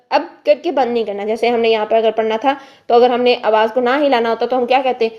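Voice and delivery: usual speaking voice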